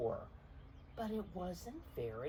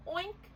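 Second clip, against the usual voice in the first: very high playful oink; high, cute piggy oink. Acted. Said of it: "said Pig".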